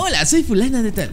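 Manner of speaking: chirpy high-pitched dubbed character; high cartoon voice. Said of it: "hace unas voces". (voz de caricatura aguda)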